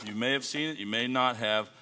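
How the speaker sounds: ordinary talk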